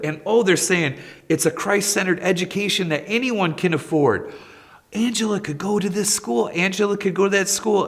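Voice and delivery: high, eager rush of good news; thrilled, pulling him over to see; excited telling husband. Retold or imitated. Mom calling her husband over, excitedly eager. Wife calls husband about school.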